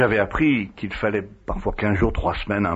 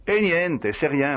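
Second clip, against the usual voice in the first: un «niente» plus aigu, qui écarte la question; ton évasif; 6 semitones higher, slower than usual. Retold or imitated. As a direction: balayer la demande d'un ton léger. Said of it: "d'un ton évasif". (réponse évasive de Padre Pio, à peine jouée)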